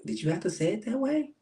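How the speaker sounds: high, fast, incredulous question; high quick mock disbelief; mock offended. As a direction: pitch it up quick, a little put-off. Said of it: "it'd be like".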